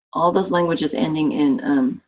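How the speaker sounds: usual speaking voice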